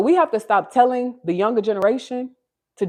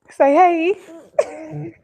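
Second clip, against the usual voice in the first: put-on high baby voice; 7 semitones higher, 3 dB louder than usual. Shown as a demonstration.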